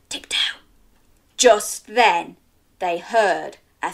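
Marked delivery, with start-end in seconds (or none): 0.1-0.6 whispered tiptoe. Shown as a demonstration. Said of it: "Can you pretend you're tiptoeing?".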